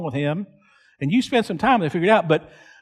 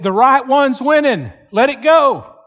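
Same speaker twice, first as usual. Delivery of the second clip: raised, higher-pitched cowboy call; retold calling out; 6 semitones higher, 4 dB louder than usual. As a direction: call someone off from stepping in. Cowboy calls out during fight.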